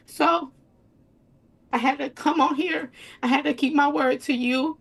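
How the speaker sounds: emotional, holding back crying; tearful. Real, not acted. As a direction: voice lifting, close to tears. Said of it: "But you cry".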